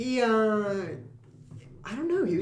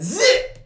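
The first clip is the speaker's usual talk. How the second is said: zip sound effect; 6 dB louder than usual. Retold or imitated. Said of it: "and I said".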